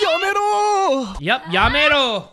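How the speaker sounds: mock anime Japanese. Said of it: "Whatever that means".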